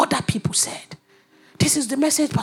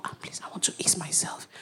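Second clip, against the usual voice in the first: very soft unvoiced whisper; whispered request; 7 semitones lower, 14 dB quieter than usual. Whispering to the protocol officer for the toilet. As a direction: ask discreetly under your breath.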